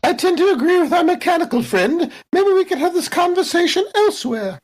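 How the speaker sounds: put-on high, fluttery old-lady exclamation; prim old lady. Jules reacting to the android reveal, prim old lady. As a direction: gasp it like a proper elderly lady.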